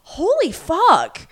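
high, slow astonished cry; drawn-out high holy-cow reaction; shocked outburst. Retold or imitated. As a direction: gasp it out in total shock. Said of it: "you're like".

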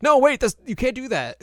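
very high, loud alarmed cry; panicked protest. Retold or imitated. Host voicing players alarmed as everyone quits, very high and loud. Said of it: "And they're like".

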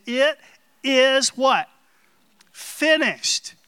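loud high preaching. Acted. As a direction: proclaim it to the congregation. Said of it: "preaching the cross".

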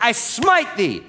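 high, slow, booming archaic smite; hammed-up thundering old-testament decree; mock biblical prophet. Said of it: "God wasn't like".

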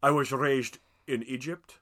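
mock a film star's Scottish accent; Sean Connery. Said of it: "It's also got Sean Connery".

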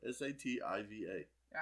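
slow letter by letter; spelling it out. Real, not acted. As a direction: spell each letter clearly. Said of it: "S what?".

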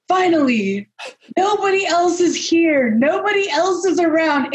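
high, put-on comic character voice; Eddie Izzard. Guest retelling a stand-up bit to host, high mock-triumphant impression. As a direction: act out the bit, pitched well up. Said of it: "Where he goes like, he's like".

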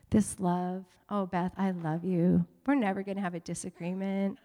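naive newlywed. Retold or imitated. Pastor imitating his younger self promising his wife Beth perfect love, dreamy. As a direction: gently send up your own young self. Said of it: "I used to think".

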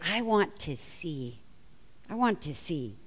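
very soft, low and earnest; hushed plea. Acted. Blind man answering what he wants from Jesus, hushed. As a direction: say it quietly, as a humble request.

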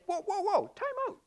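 high, sudden whoa whoa; startled protest. Pretend. Objecting listener protests.

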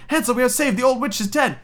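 put-on high, excited girl voice; crying out joyfully. Gretel freeing Hansel from the stable, high joyful cry. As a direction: cry the good news, pitched right up. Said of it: "opened his little stable and cried".

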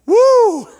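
loud excited holler woo; joyful high whoop. Acted. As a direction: shout woo for joy.

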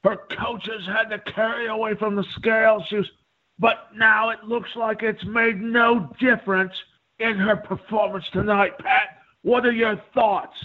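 high, projected mock Australian commentator; exaggerated Australian broadcast delivery; Michael Schiavello, Australian accent. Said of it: "doing my best to do an Australian".

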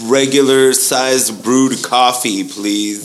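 slow, spelled-out ordering; slow careful order. Shown as a demonstration. Advising friend how to order coffee, slow and careful. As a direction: say it slowly and clearly. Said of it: "That's what you gotta say".

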